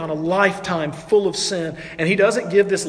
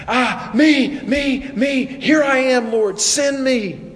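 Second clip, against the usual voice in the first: high, loud, stretched-out pleading to be picked; like an overexcited pupil begging to be chosen; eager volunteer; 8 semitones higher, 4 dB louder, much slower than usual. Acted. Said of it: "can't even get his hand up fast enough".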